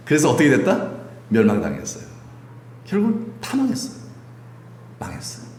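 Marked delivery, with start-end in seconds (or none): none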